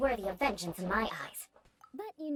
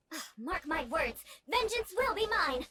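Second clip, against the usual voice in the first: the vow rising much higher into a cry; vengeful shout; 5 semitones higher than usual. Real, not acted. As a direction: fling the threat after him. Shouting a vow at departing man.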